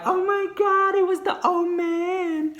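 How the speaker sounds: high, slow, drawn out shock; shocked squeal. Retold or imitated. Gossiping with friend, high squeal of shock.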